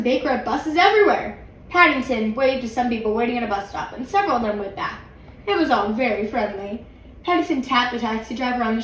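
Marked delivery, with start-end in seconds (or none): none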